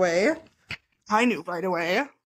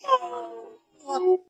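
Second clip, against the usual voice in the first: pained cry; 9 semitones higher than usual. Real, not acted. Upset at sad movie reference.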